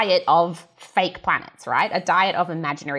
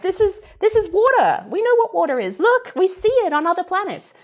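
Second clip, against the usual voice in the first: higher, eager show-and-tell voice; excited explainer; 10 semitones higher than usual. Retold or imitated. Scientist playing the press team, eagerly showing the public water. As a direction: pitch it up like a keen guide pointing at water. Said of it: "relating it to us and being like".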